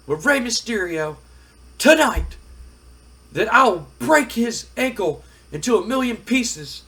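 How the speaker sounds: loud, high-pitched, vehement promo; acted wrestler demanding a rematch; ranting wrestler. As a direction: build an angry threat, punching key words.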